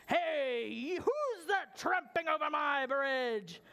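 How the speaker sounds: drawn-out, growly troll call; gruff troll challenge. Acted. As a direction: challenge the trespasser slowly as a troll. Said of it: "out popped the troll and he said".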